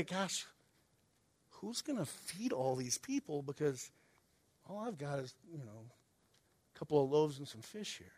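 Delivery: hushed, hesitant mock concern; a little worried. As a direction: trail off softly, with uneasy pauses. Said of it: "started to get a little concerned".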